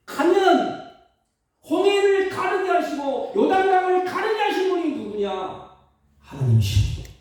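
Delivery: high, loud preaching to the congregation; fiery preaching. Acted. Preacher urging the congregation, full-force preaching. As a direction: preach it out, pushing to the back row.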